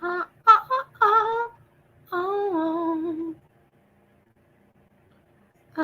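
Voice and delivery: very high drawn-out ohs; ghostly oh moans. Pretend. Streamer moaning as a Halloween ghost. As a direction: moan like a wandering ghost.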